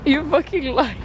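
high, drawn-out laughing protest; laughing accusation. Real, not acted. Friend drank the juice she holds him to, laughing accusation. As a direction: accuse teasingly through laughter.

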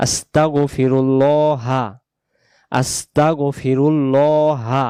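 আস্তাগফিরুল্লাহা said very slowly, each syllable drawn out; careful pronunciation. Shown as a demonstration. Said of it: "এই উচ্চারণটা আগে ঠিক করি". (আস্তাগফিরুল্লাহা ধীরে, প্রতিটি অক্ষর টেনে)

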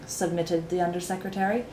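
plain reading voice

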